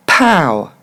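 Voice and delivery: "Pow" with a burst of air; aspirated English P. Shown as a demonstration. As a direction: pop the P in "Pow", long falling ow. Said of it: "relâchée d'air entre mon P et mon ow".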